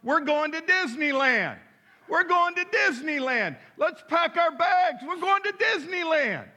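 put-on high, bright-eyed child shouting with joy; a preacher's high little-girl voice, gleefully chanting; excited little girl. Granddaughter running through the house, high excited child voice.

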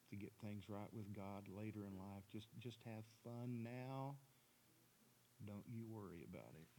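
the devil whispering temptation in his ear; seductive whisper. Acted. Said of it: "I'm gonna whisper in his ear".

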